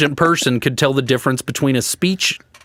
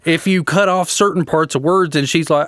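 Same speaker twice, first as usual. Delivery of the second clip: ordinary talk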